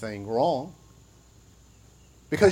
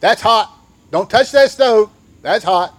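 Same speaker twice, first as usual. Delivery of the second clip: higher, louder parental scolding; warning parent; 5 semitones higher, 5 dB louder than usual. Retold or imitated. Parent warning a small child about the hot stove, sharp and loud. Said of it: "you'd hear".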